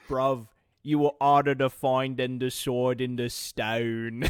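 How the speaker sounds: hammed-up South London cockney accent; jokey cockney accent, South London; mock cockney. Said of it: "we've made Fusions, like, super cockney".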